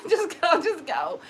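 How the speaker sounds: high, laughing shooing; laughing plea. Acted. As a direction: beg them to leave through a laugh. Acted plea, breaking into laughter.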